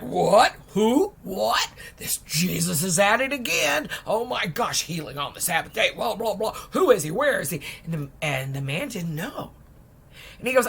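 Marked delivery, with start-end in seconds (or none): 0.0-7.6 outraged fluster. Retold or imitated. Pharisees outraged about healing on the Sabbath, sputtering. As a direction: sputter at the news like scandalized officials.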